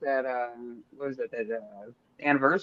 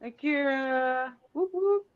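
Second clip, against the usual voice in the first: higher, drawn-out "Akira" then whoop; cheering a viewer; hype shout-out; 9 semitones higher, slower than usual. Real, not acted. Greeting Akira in the chat, higher stretched hype call.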